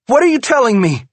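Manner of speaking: high, loud, fast furious demand; angry demand. A narrator's reading. Wife demands the truth from husband, loud and furious. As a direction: fire the question at him. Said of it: "she demands".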